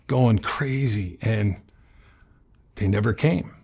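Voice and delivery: normal talking voice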